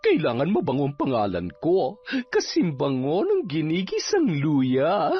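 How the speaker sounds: self-satisfied villainess, breaking into laughter; gloating captain. Acted. Captain boasting to Levi about her porridge, gloating. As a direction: savour your own scheme, then laugh.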